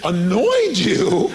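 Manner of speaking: incredulous. Retold or imitated. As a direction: echo it back in rising, stretched disbelief. Incredulous echo of friend's odd word.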